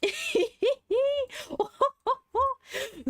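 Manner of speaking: long high laugh close to tears; laughing cry. Real, not acted. Streamer seeing the new character, overjoyed laughing cry. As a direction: laugh helplessly with joy.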